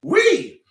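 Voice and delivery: "We" pitched very high and loud; incredulous stress. Real, not acted. Driver objecting to cousin's "We", incredulous. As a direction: punch "We" way up high in disbelief. Mocking cousin's claim of shared dilemma.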